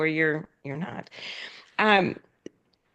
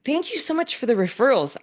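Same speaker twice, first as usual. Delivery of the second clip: excited thanks; 6 semitones higher than usual. Retold or imitated. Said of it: "they were really excited".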